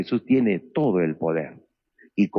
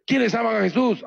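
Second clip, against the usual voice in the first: calling out; 12 semitones higher than usual. Retold or imitated. Preacher calls out to prisoners.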